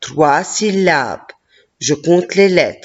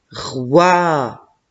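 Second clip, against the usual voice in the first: ordinary talk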